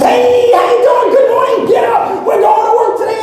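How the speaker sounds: high, loud, hyped-up coach shout; morning coach. Acted. Imagined coach rousing you from bed, exuberant high shouting. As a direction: holler a big laughing wake-up like a pep coach. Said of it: "having a coach in the morning".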